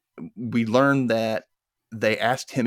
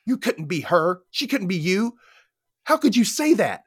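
a touch of a high, upset snap; mock snapping; 9 semitones higher than usual. [name] snapping back at Angela, mildly mock upset. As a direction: lightly snap back at her. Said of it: "[name] immediately snaps back".

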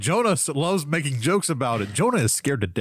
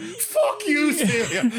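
put-on very high, laughing Irish-accented curse; much slower than usual. Retelling the outburst at Celia, mock Irish accent. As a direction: hurl it out in a high Irish brogue. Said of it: "In, in my Irish accent".

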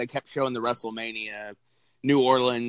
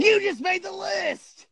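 high, slow wrestler's catchphrase shout; bad List impression; slower than usual. Co-host condemning friend for cheating on the podcast, bad List impression. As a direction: shout it as a drawn-out, hammed-up punchline. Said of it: "gonna do a bad List impression".

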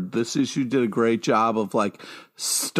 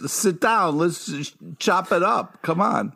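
put-on higher Philly accent; Philadelphia accent; 5 semitones higher than usual. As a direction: coax him in Philly style. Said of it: "using that Philly accent".

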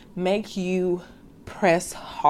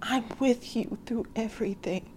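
hushed, like God comforting a hurting listener; gentle voice of God; 3 semitones higher, 10 dB quieter than usual. Acted. God answering a struggling believer, softly and tenderly. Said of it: "And God is like".

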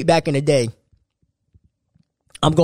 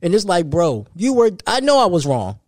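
normal talking voice

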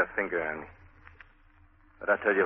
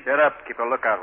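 loud, higher barked command; sharp order; 4 semitones higher, 8 dB louder than usual. Acted. Marty barks at the kid.

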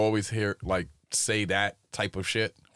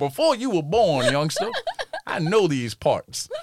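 put-on slow, higher, laughing old man; old-timer voice; 7 semitones higher, 3 dB louder than usual. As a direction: lightly play a crusty old-timer. Old-timer voice mocking himself.

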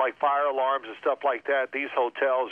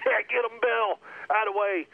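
mock cheering teammates; 8 semitones higher than usual. Teammates cheering Bill's yelling.

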